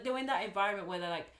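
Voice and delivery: ordinary talk, nothing marked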